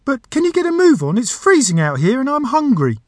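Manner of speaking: very high, squeaky complaining genie; impatient genie. A narrator's reading. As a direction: whine to get moving as a squeaky genie.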